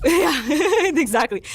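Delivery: amused, giggly reply; real laughing agreement. Real, not acted. Amused by host's Wi-Fi joke.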